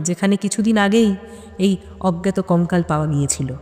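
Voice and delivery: plain reading voice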